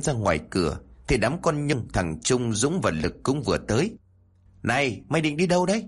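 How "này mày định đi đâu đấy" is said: fast, higher-pitched quick question; quick-mouthed question. A narrator's reading. Girl stops her friend at the door, quick-mouthed. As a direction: jump in with a rapid question. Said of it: "Con Nhung nhanh miệng hỏi".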